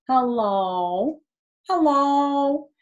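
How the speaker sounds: high, loud sing-song cat hello; cat saying hello. Retold or imitated. To the listening children, imitating her talking cat. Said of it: "she said".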